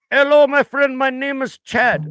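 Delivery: high, loud mock call-center greeting; imitated agent. Mocking the agent who called himself Chad, high and loud. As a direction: ham up a cheery foreign agent. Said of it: "He's like".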